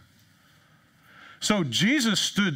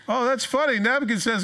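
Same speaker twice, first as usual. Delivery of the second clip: amused guest; 8 semitones higher, 4 dB louder than usual. Acted.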